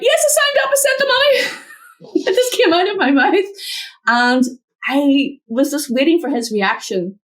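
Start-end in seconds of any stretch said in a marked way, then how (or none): 0.0-1.6 blurted confession. Retold or imitated. Blurting to her husband in the car, high and sudden. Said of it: "I just blurted out". 2.1-3.4 amused, laughing through it. Real, not acted. Laughing to the host about her blurt, giggling.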